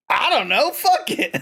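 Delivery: high-pitched mock cluelessness; mock dismissive, pitch climbing at the end; careless shrug-off. Acts out platform's careless answer.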